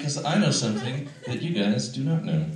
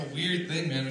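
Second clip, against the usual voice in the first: ordinary delivery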